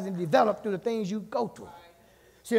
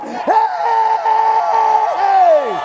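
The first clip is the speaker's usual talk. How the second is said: long, high hollered "hey"; preacher's shout; 13 semitones higher, 11 dB louder than usual. Real, not acted. Preacher shouts to God before church, long high holler. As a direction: sustain the holler, then punch a second "hey". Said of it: "when T D Jakes shout".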